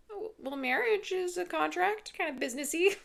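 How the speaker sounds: high-pitched flimsy excuse; feigned innocence. Retold or imitated. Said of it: "she's trying to be like".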